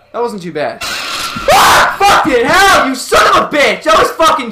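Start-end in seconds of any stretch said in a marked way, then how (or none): 0.8-2.0 sudden high unvoiced shriek of fright; startled scream. Real, not acted. Jump scare in horror game. 2.0-4.5 loud furious curse after a scare; angry shout. Real, not acted. Cursing after jump scare.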